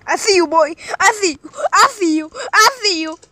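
loud, high taunt. Real, not acted. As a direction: call him out, teasing. Taunting enemy loudly.

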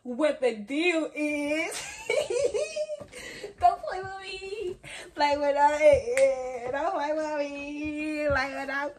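high, laughing and crying with joy; giddy and overwhelmed; laughing excitement. Real, not acted. Celebrating her new camera, laughing. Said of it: "I am so freaking excited".

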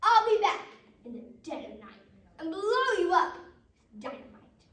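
loud, high-swooping vow; big blustering wolf; wolf's threat. Acted. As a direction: threaten at full volume. Wolf vows to return.

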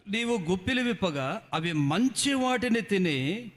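slow loud Bible reading; scripture reading. Acted. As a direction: read the verse solemnly and slowly. Reads psalm aloud.

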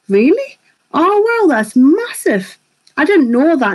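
high pitched, stretched out wow; real pleasant shock; delighted surprise. Real, not acted. Hears she was someone's favourite.

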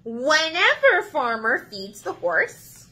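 slow, lilting storybook reading; reading to children. Acted. Librarian starting The Cow Loves Cookies for children, lilting. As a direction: read it sweetly to little listeners.

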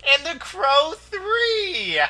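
high, very slow, laughing mock wail. Listing movies to viewers, hammed-up laughing wail. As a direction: stretch it into a hammed-up cry.

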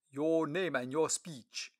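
slow, loud, stately official; Lord Treasurer voice. Acted. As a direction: speak like a dignified royal treasurer.

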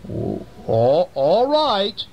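high, greedy weasel voice; high hungry weasel threatening mouse soup. Acted. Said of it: "And the weasel said".

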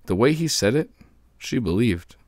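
plain reading voice

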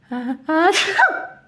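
loud rising ah-ah-ah before a sneeze; sneeze build-up. Acted. Sneezing man greets the lady, loud sneeze wind-up. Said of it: "éternua soudain quelqu'un".

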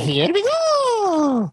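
very high, stretched-out cheer; hammed-up falsetto whoop; whooping launch cry. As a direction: draw it out as the ship takes off. Calling out as ship launches.

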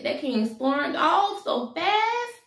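high, drawn-out amazement; dramatic exclamation. A narrator's reading. Storyteller tells how the king left, dramatic exclamation. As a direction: stretch it out and swing up high at the end.

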